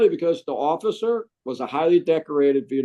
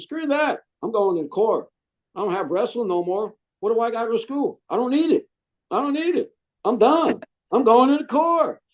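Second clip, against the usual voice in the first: put-on high, worked-up retelling of his teenage protest; defiant outburst; 10 semitones higher than usual. Teen tells mom he's quitting school, high and defiant. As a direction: fire it back at her, all revved up.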